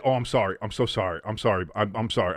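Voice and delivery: ordinary talk, nothing marked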